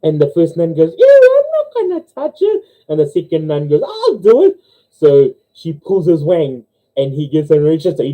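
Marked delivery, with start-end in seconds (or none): 1.0-2.6 squealed falsetto disgust; disgusted joke nun. Acted. 3.8-4.6 eager high-pitched nun voice; volunteering joke nun. Acted.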